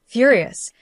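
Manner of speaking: usual speaking voice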